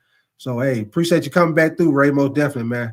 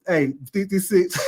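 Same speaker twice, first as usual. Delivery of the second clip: laughing. Real, not acted. Laughing at a rival's joke.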